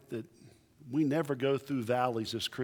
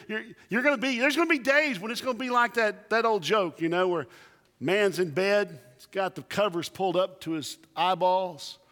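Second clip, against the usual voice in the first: normal talking voice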